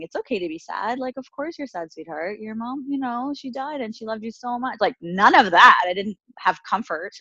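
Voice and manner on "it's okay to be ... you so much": soft, higher, tender reassurance; comforting. Retold or imitated. An imagined adult comforting a grieving six-year-old, soft and tender. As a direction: gently soothe a grieving child, softly. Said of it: "never saying".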